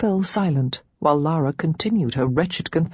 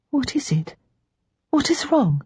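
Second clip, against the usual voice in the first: high, anxious questions; a sister's sudden worry; worried; 9 semitones higher than usual. Acted. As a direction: ask in alarm what's wrong. Rachel sees Lara's distress.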